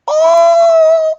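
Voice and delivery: high, loud, drawn-out ooh; delighted "ooh". Real, not acted. Delighted squeal at the reading.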